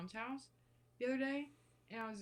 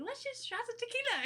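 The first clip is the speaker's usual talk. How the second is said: put-on very high, loud party-girl squeal; excited imitation; 7 dB louder than usual. Imitating a girl urging tequila shots, high excited mimicry.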